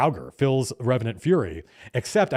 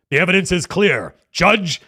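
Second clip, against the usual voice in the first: louder, higher, declare it to the court; hammed-up inquisitor verdict; booming accusation; 5 semitones higher, 6 dB louder than usual. Inquisitor head addressing the Judge, booming.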